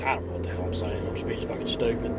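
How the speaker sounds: low, soft self-mocking mutter under the breath; a quiet aside, laughing at your own rambling. Real, not acted. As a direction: half-whisper it to yourself, embarrassed.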